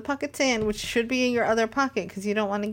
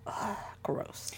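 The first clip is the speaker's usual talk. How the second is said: disgusted whispered ugh; annoyed ugh; 14 dB quieter than usual. Real, not acted. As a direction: breathe it out in disgust. Grossed out by prior's hat.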